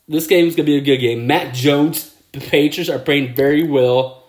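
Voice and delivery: ordinary delivery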